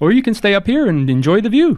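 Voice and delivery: high-pitched, apologetic crew member; chirpy high host voice; eager, polite ship hand Onion. Acted. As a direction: play a courteous, high-voiced deckhand, lilting upward. Game master plays Onion replying.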